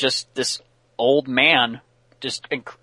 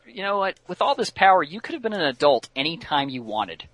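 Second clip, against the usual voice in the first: goading; 6 semitones higher than usual. Retold or imitated. Batman goading the boy villain.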